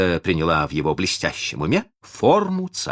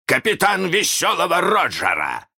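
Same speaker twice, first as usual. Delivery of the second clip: high, shouted title; grand mock-captain boast; imitating Hook's voice; 13 semitones higher, 4 dB louder than usual. A narrator's reading. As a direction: proclaim the rank as a pirate captain. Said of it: "его же голосом".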